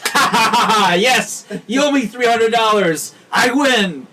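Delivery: loud, high gloating cackle and crowing; gloating triumph. Retold or imitated. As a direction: crow over the win, laughing loudly. Gloating winner in the joke.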